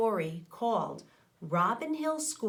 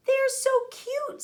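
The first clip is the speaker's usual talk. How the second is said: much higher, louder squeal of delight; cute child voice; 7 dB louder than usual. Acted. Child Nia gushing over baby lettuces, high delighted squeal. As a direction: squeak it out like a delighted little kid.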